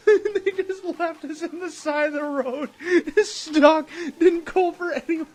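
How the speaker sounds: high, loud laughing through the words; can't stop laughing while telling it; laughing in disbelief; laughing hard. Real, not acted. Laughing while retelling being abandoned.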